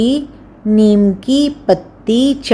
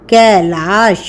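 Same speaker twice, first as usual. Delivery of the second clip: ordinary talk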